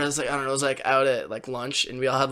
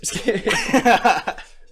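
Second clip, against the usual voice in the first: laugh; 5 semitones higher than usual. Real, not acted. Laughs after his answer.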